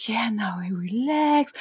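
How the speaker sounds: soft whispery imitation of a calm yoga teacher; mock calm teacher voice. Mimicking the yoga teacher's calm voice, hushed and soothing. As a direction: breathe it out like a sleepy instructor. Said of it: "with that calm voice".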